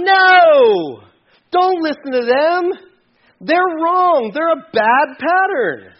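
high, forceful shout as Paul; urgent objection. Acted. Paul stopping people from following the world, urgent shout. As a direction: shout a desperate warning, high and loud. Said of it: "And Paul's going".

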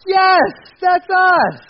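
high, loud, long-drawn cheer; mock exultant shout. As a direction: bellow it slowly in hammed-up self-congratulation. Congregation exulting at praise, voiced.

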